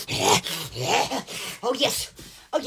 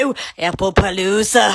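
low, odd voice imitating the Disney man; excited TV character; 10 semitones lower than usual. Quoting a Good Luck Charlie character, low excited gush. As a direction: mimic the Disney man's low gushing "Ooh, Apple Palooza". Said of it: "he got so excited talking about apples".

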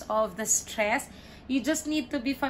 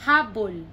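"Habol" as HA-bol, falling; stress on first syllable; 2 semitones lower, 5 dB louder than usual. Shown as a demonstration. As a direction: punch the HA of "Habol". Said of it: "the stress is in the middle".

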